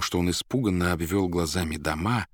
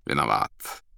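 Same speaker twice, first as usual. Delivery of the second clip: low, mild, gentle response; soft apology; 7 semitones lower, slower than usual. A narrator's reading. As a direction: beg pardon softly in a lower voice. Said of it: "мягко отозвался неизвестный". (мягко, низким голосом)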